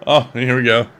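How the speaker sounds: usual speaking voice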